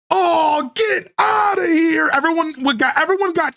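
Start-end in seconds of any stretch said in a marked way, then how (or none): 0.1-2.1 imitating a stunned chat yelling; mock chat outcry. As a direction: throw up a shocked chat shout, hammed up. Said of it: "everyone was so like".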